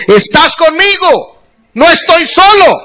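voz quebrada, más aguda y muy lenta; llorando. Retold or imitated. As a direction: suplica al Señor entre lágrimas. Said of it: "empecé a llorar y a arrastrar mi maleta llorando". (rezo llorando con la voz aguda y rota)

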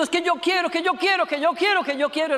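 put-on high, insistent whine like a demanding child; mock tantrum. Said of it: "se pone a pegar gritos y llora".

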